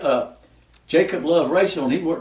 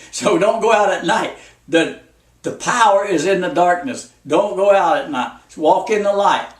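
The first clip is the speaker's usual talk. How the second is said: loud, insistent preacher's warning; preaching at full force; 5 dB louder than usual. Acted. Preacher warning his flock, loud and insistent. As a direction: drive the warning home to the congregation.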